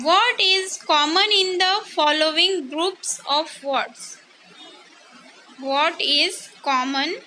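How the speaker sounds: slow, deliberate read-aloud of the question. Shown as a demonstration. Teacher reading the worksheet question slowly to students.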